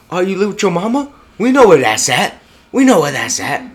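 tough-guy taunt. Retold or imitated. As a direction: sneer it like a fake hard man. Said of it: "this dude trying to act hard goes".

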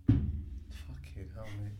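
slow, whispered fucking hell; drawn-out breathy exclamation. Real, not acted. Friend reacting under his breath to the wire-cutting plan.

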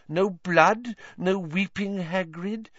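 a hint of a dreamy, eerie seer, coaxing; lightly voiced mystic teacher. Trelawney urging Harry to look closer, lightly voiced seer. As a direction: lean in and lure him to see doom.

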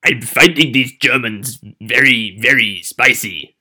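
grumbly mock German accent. Host plays to their strengths on a dare, grumbly German accent. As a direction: put on a grumbly German, slowing on the last words.

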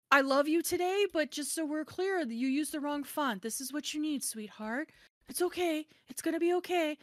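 high, exaggerated coddling; mock-sweet reassurance. Author mocking the gentle email the designer wanted, mock-sweet. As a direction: placate them with sarcastic sweetness.

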